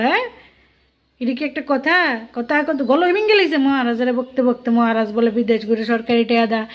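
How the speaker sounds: usual speaking voice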